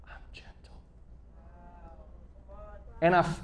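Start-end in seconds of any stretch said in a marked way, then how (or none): 0.0-0.7 breathy unvoiced whisper of the verse; a soft whisper in the ear. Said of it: "I hear the whisper".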